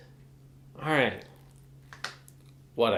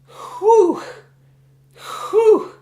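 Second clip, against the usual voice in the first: exasperated whew; 11 dB louder than usual. Real, not acted. Exasperated reaction to rude comment.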